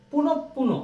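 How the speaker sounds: high-pitched পুনঃ পুনঃ as "punop-puno"; doubled p. Shown as a demonstration. Teacher showing students the next example, doubled consonant. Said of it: "শুধু পুনঃ পুনঃ না, পুনঃ পুনঃ".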